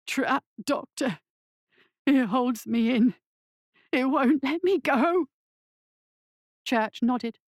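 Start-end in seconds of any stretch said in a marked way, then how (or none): 0.1-5.3 high, anguished plea; pleading. A narrator's reading. Bound patient begging his doctor, pleading. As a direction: beg for help, rising in desperation. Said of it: "His voice was pleading".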